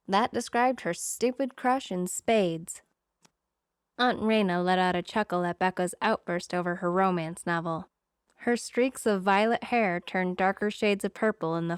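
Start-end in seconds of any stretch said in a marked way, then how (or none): none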